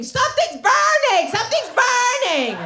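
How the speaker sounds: high, slow panicked mock yell; husband screaming. Husband panicking at the smell of burning hair, high mock yell. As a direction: squeal it like a frantic husband. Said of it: "My husband's screaming".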